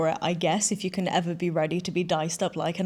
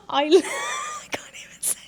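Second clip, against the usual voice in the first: real giggling, words half whispered; can barely get the words out for laughing; breathless laugh-through; helpless laughter. Real, not acted. Laughing too hard to finish.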